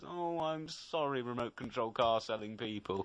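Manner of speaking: soft, slow mock-apologetic imitation; sympathetic, middle-class. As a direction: coo a hushed, over-polite apology. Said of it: "very sympathetic and very middle class".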